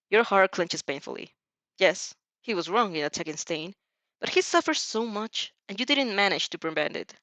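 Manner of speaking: usual speaking voice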